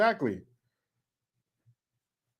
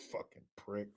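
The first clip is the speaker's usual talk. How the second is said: usual speaking voice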